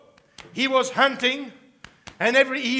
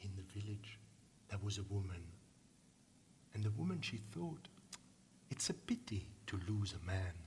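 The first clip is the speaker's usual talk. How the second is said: much softer, hushed storytelling voice; hushed narration; 14 semitones lower, 17 dB quieter than usual. A narrator's reading. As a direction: draw the listeners in with a near-whisper.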